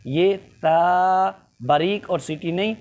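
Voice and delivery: normal talking voice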